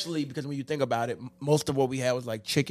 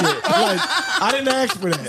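laughing; 7 semitones higher, 3 dB louder than usual. Real, not acted. Laughing complaint.

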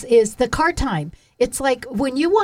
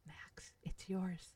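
slow, hushed secret whisper; 3 semitones lower, 24 dB quieter, much slower than usual. Pretend. Suggesting a surprise line for the son, whispered. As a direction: whisper it very softly and slowly. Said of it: "You don't have to whisper".

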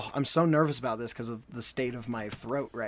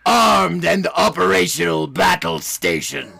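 louder, slow shouted villain; Emperor Palpatine; 4 semitones higher, 10 dB louder, slower than usual. Acted. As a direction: bellow it like an evil emperor. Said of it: "That's the first person you have to impersonate".

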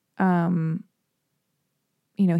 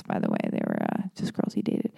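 a soft, whispered side comment; hushed aside; 7 dB quieter than usual. Real, not acted. Hushed aside about the girls.

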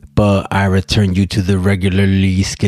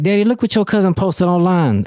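higher, lightly voiced young daughter; 9 semitones higher than usual. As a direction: imitate his daughter telling on the cousin. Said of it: "she was like".

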